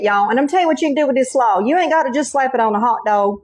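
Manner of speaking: normal talking voice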